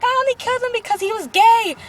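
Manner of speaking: high, loud hammed-up outburst; mock screamed confession. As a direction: blurt it out in a soap opera yell. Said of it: "they're screaming at him".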